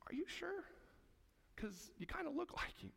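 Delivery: high, sly doubting question; suspicious crowd. Retold or imitated. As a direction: needle him with a high, teasing doubt.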